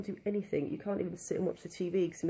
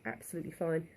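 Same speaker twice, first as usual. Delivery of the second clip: usual speaking voice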